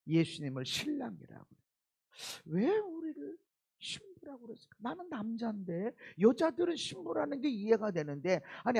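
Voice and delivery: ordinary talk, nothing marked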